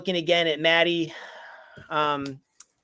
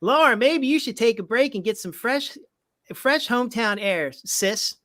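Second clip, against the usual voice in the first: light Southern US accent, a little higher; 6 semitones higher than usual. Shown as a demonstration. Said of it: "Southern accent".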